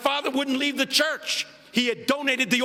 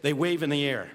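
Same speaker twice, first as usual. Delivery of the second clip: ordinary talk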